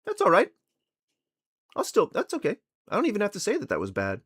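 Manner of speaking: mock a careless believer. Streamer mocking a believer shrugging off the Bible, mocking. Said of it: "be like".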